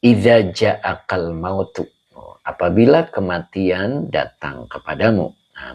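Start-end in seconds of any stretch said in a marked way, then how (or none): 0.1-1.8 Idza ja'akal mautu recited slowly, syllables held; slow Arabic recitation. Shown as a demonstration. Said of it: "ulangi lagi".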